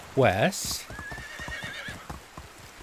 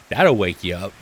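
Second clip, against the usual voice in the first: usual speaking voice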